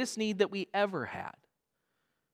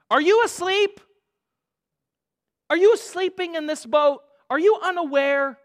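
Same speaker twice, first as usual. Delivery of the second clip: pleading cry; 8 semitones higher, 5 dB louder than usual. Acted. Voicing a desperate prayer.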